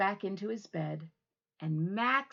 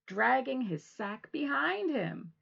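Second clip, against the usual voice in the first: usual speaking voice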